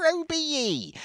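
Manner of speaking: high, hammed-up West Country yokel accent; stock rural Archers accent. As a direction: send up a radio-soap country accent, pitched high. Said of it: "suddenly sounds like they're straight out of bloody Ambridge".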